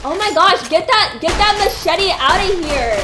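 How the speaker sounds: loud, high outcry; alarmed yell. Real, not acted. Player yelling at an enemy hacking him, loud. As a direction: shout the enemy's machete away. Enemy crippled his leg.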